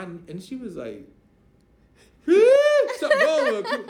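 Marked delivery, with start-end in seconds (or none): 2.3-3.8 very high, slow, loud laughing cry; laughing shriek. Retold or imitated. Niece shrieking with laughter at his claim.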